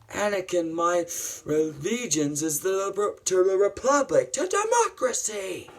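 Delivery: high voice climbing to a cry; theatrical earnest protest; acted impassioned declaration. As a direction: plead your principles, pitch rising. Acts out Obi-Wan's declaration.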